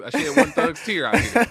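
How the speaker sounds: laughing through a joke about crying; high, loud burst of laughter on the words. Real, not acted. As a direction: laugh your way through the line. Jokes about shedding a tear.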